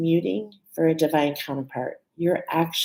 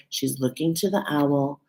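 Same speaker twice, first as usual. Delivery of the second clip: ordinary talk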